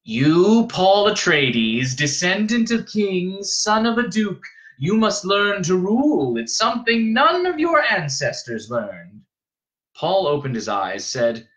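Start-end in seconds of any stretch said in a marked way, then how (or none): 0.0-9.2 high, slow, commanding matriarch; boy echoing a stern old woman; old woman's tone. A narrator's reading. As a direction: intone it like a grave decree. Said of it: "took on some of the old woman's tone".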